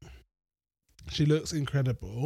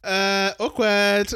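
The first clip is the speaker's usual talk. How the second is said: mock awkward; 7 semitones higher, 6 dB louder, much slower than usual. After being snapped at.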